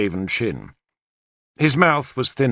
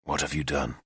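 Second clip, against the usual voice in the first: low, much softer question; quiet; 8 semitones lower, 10 dB quieter than usual. Acted. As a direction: hold it down, barely voiced. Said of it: "Arthur asked quietly".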